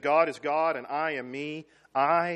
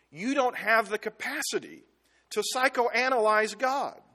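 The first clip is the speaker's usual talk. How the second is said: ordinary talk, nothing marked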